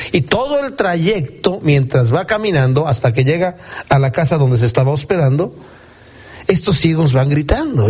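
ordinary talk, nothing marked

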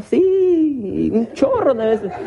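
very high, long-held mock yes; comically emphatic admission. Woman admitting she cursed her husband, hammed-up high admission. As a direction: squeal the yes, hamming up her eagerness. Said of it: "¿Lo ha maldecido?".

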